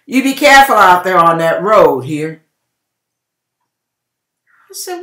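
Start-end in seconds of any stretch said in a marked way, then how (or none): 0.1-2.4 loud, demanding warning from husband; his warning tone. Retold or imitated. Husband warns his wife about the road, loud and demanding. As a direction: bark the warning like a stern husband. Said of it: "share the tone that he said it in".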